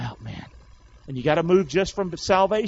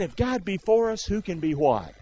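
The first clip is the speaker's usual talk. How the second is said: ordinary talk, nothing marked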